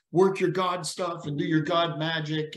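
usual speaking voice

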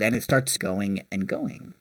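ordinary talk

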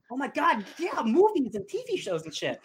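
high, excited praise; giddy fan reaction; thrilled gushing. Retold or imitated. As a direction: gush like a delighted viewer. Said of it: "but I'm like".

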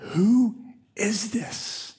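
breathy hushed disbelief; a hushed, frightened who is this; awed whisper. Retold or imitated. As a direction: whisper it in stunned awe. Disciples' stunned question.

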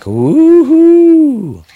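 high squealed woohoo; mock cheer. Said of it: "She's like".

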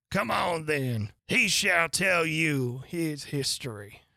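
slow, weighty royal threat; haughty queen handing down a sentence; imperious plummy grande dame. A narrator's reading. Queen voiced in read story.

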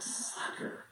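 whispered sly gloat. Retold or imitated. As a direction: breathe it out sneakily. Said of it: "I'm like".

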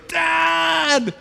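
high excited cry of Dad!; child running to father, high glad shout; joyful call. Retold or imitated. Child running to God calling.

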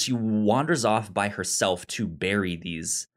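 normal talking voice

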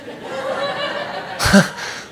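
a bright burst of laughter; high laugh. Real, not acted. Preacher laughs at his own impatient-father bit, high. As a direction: laugh at the joke just made.

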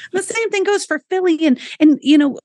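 normal talking voice

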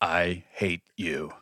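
slow, low, soft mock grumble; mock wife quietly seething at her husband; deadpan hate. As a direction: drag it out flat and defeated. Wife's deadpan reply.

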